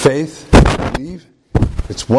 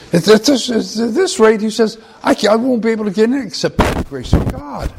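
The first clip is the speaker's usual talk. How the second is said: much higher, frantic despair; despairing man; 11 semitones higher than usual. Retold or imitated. Man gives up hope at heaven's gate, high and frantic. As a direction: blurt it out in panic. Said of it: "eyes began to roll back in his head".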